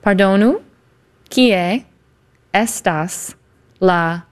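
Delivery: "Pardonu ... kie ... estas ... la", one word at a time; tentative repetition. Shown as a demonstration. Host attempting a tricky phrase "Pardonu, kie estas la", word by word. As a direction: sound out "Pardonu, kie estas la" with long gaps. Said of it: "getting a little more tricky".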